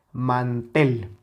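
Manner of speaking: "mantel" with strong final "tel"; acento en la última sílaba. Shown as a demonstration. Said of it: "la sílaba tónica es la última". ("mantel" con fuerza en "tel")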